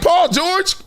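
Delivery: high, slow incredulous rise; high-pitched slow disbelieving question; mock disbelief. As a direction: ask it as if it can't be true. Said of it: "You were like".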